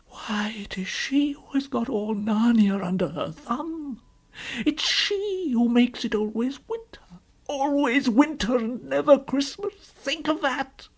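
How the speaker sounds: high, drawn-out lament; woeful faun. Acted. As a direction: bewail it, stretching the phrases. Faun laments the endless winter.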